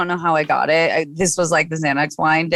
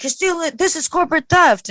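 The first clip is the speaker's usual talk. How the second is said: higher-pitched mock boss from Bensonhurst; hammed-up Italian boss voice, pitched up; 10 semitones higher than usual. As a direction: boss calling in an employee, a lightly voiced Italian boss. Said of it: "thick Italian accent".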